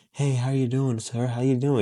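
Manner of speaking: soft seductive greeting. Shown as a demonstration. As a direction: greet him far too softly and sweetly. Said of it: "I'm not gonna talk to him like this".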